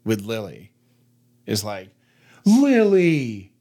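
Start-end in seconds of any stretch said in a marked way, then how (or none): 2.5-3.5 high, loud name, drawn out in disbelief; shocked scold. Shown as a demonstration. Owner showing her dog-correcting tone, shocked and loud. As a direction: say the name as if shocked she'd bark. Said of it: "There it is".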